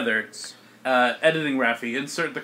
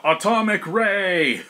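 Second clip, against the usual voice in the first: slow, raised attack call; mock attack yell; 4 semitones higher, much slower than usual. Game master voicing Zap Zap firing a beam, mock heroic yell. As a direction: call the move name out, drawn out. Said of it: "And he yells out".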